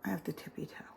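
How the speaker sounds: soft whispered aside; whispering. Real, not acted. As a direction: whisper it to yourself. Whispered aside while reaching.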